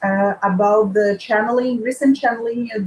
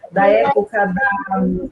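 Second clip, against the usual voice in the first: normal talking voice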